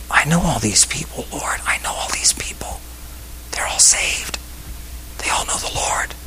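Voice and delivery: a hushed, breathy whisper to the Lord; whispered protest. Retold or imitated. Pastor whispering his objection to the Holy Spirit, hushed. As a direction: whisper it like a secret argument with God.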